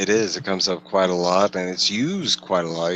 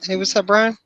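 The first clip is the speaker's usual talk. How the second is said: much higher, bright hello to a newcomer; cheery greeting; 13 semitones higher than usual. Real, not acted. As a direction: greet the chat guest brightly. Greeting a viewer joining.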